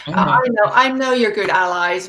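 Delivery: ordinary talk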